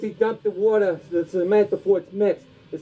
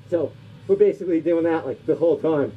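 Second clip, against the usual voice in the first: ordinary delivery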